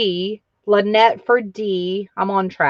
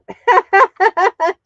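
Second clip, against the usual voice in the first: loud high giggle; high burst of laughter; 12 semitones higher, 9 dB louder than usual. Real, not acted. Host laughing at her own slip, high burst of laughter. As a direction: laugh out at yourself.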